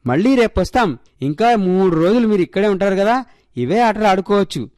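cheerful rural uncle, coaxing and lively; a jolly farmer tempting kids to come play; lightly voiced upbeat villager; village uncle. A narrator's reading.

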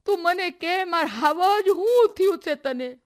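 slow, high, loud anxious plea; mounting panic. A narrator's reading. Mother begging her crying daughter to speak, panicking. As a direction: beg for an answer, stretching the words. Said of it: "મોંઘીબાઈનો ફફડાટ વધતો હાલ્યો".

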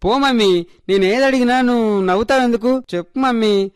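put-on high-pitched, petulant child; miffed little girl. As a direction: sulk at mum, then press dad for an answer. Child miffed at being laughed at. (అలిగిన చిన్నపిల్ల గొంతు)

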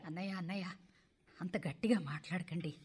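hushed pleading. Acted. Mother shushing the family friend with children at home, hushed. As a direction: hush him so nobody overhears.

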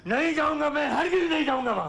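high-pitched desperate protest; frantic refusal. Acted. Father refusing the asylum, frantic high protest. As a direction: refuse wildly, voice far up.

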